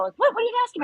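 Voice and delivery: a friend caught off guard, pitch jumping up; startled friend. Retold or imitated. Friend's startled reaction voiced.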